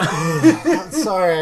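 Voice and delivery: laughing. Real, not acted. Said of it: "trying to put on a brave face".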